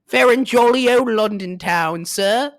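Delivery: high, loud, slow British toff voice; hammy British accent. Pretend. Taking listeners on a journey to London, in a hammed-up British accent. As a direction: play the posh Londoner to the hilt. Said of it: "take them on a journey to".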